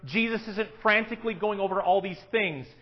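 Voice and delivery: ordinary delivery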